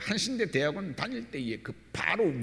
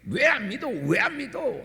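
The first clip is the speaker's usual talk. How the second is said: scolding; 9 semitones higher than usual. Retold or imitated. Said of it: "나무라듯".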